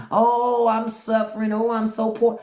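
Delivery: hammed-up suffering, mocking; mock whining. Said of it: "all y'all fucking whining".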